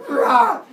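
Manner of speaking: loud, high mock battle cry; hammed-up lunging war cry; attack roar. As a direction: roar as you pounce. Kronos lunges at father.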